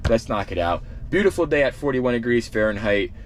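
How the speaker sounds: ordinary delivery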